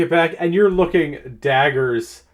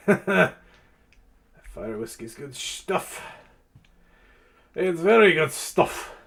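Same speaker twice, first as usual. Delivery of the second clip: put-on drunk voice; 4 semitones higher than usual.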